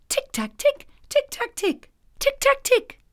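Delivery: high ticking tapping noise, rising; tapping sound effect. A narrator's reading. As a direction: tap out tick-tack like a tiny hammer. Strange sound of shoemaking.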